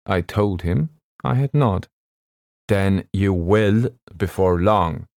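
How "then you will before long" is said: slow, grave warning. A narrator's reading. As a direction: speak it slowly like a solemn doctor's warning. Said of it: "he said very gravely".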